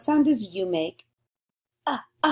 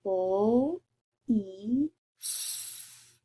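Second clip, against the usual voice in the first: sounding out; much slower than usual. Shown as a demonstration. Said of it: "Let's say 'em again".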